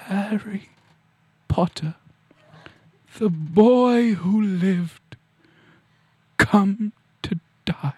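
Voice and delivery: very slow, stretched-out villain delivery; theatrical dark-wizard menace, long pauses; menacing villain. Acted. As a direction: draw out each phrase as a death threat. Villain impression addressing Harry Potter.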